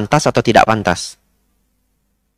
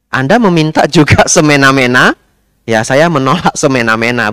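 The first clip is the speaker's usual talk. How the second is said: ordinary talk, nothing marked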